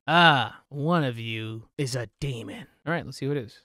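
usual speaking voice

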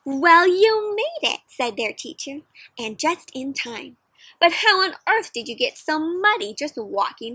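lively storybook read-aloud for a class; reading to children. Acted. Teacher reading a picture book aloud to her class, lively. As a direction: paint the story for the kids.

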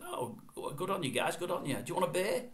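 high-pitched, put-on Australian accent; Aussie accent. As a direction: imitate friendly Aussies calling down to fans. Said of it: "in that Aussie accent".

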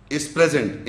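ordinary talk

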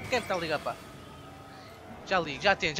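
ordinary delivery

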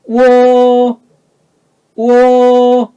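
우어 held high, loud and level; high first tone. Shown as a demonstration. Teacher modelling first tone for students, 우어 high and level. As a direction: sustain 우어 on one high note. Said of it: "일성 높게 잡아서".

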